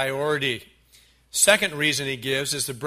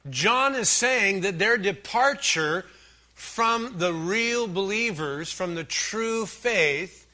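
raised-pitch, insistent pulpit delivery; emphatic preaching; 5 semitones higher than usual. Real, not acted. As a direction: hammer the point home phrase by phrase. Preaches the point home.

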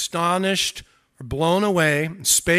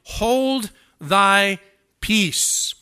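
loud, raised command, each word set apart; rebuke; 4 semitones higher, 7 dB louder, much slower than usual. Acted. Jesus rebukes the demon in the synagogue, loud rebuke. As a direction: rebuke firmly and loudly, with weighty pauses. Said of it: "rebuked him".

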